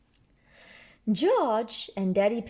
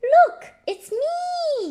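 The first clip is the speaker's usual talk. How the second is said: put-on high squeaky baby-like voice; 10 dB louder, much slower than usual. Susie voiced showing baby photo.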